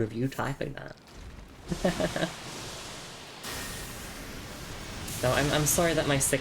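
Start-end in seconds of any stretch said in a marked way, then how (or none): 1.7-5.2 breathy wheeze laugh; silent wheezing laugh. Real, not acted. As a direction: laugh without voice.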